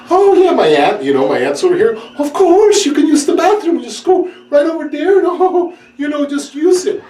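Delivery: high, bright hostess voice, laughing; aunt's high voice. Retold or imitated. Aunt telling her date's friend to use the bathroom, high and laughing. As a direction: sing it out like a flustered aunt.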